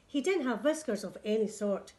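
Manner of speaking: ordinary delivery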